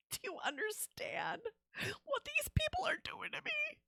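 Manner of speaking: high, breathless whispered whimper; whispered mock despair. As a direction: whimper it like a hammed-up victim. Mock despair about chat.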